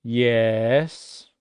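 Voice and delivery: an impatient teacher's dipping "Yes"; out of patience. Shown as a demonstration. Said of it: "be that teacher who's really out of patience".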